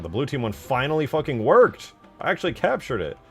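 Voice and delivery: loud, high burst of triumph; elated relief. Real, not acted. Streamer thrilled his blue team capture finally works, elated. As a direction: exclaim it high and loud in real excitement.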